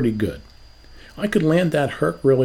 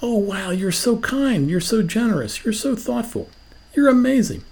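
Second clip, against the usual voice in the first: over-the-top grateful gush; gushing praise; 7 semitones higher than usual. Retold or imitated. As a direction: pile on the compliments, hammed up. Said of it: "Did you say".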